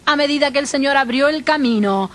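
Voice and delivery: normal talking voice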